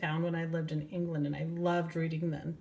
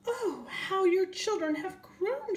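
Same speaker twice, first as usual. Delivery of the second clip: much higher, slow gushing squirrel voice; delighted squirrel; 14 semitones higher than usual. Acted. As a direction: coo over the grown children. Missus Squirrel greets Henrietta.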